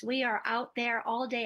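normal talking voice

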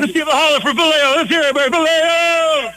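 rally the crowd for Vallejo; hype call. Real, not acted. Host hyping crowd cheer.

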